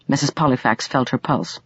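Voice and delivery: ordinary delivery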